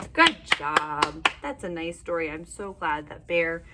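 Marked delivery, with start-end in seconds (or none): none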